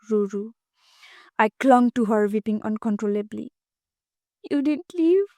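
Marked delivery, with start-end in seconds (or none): none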